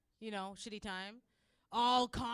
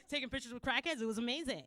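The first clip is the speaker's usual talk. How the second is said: ordinary talk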